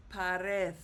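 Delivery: pared said pa-RED in Spanish; stress on last syllable. Shown as a demonstration. Teacher showing how it's pronounced, pared stressed on last syllable. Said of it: "so it's pronounced".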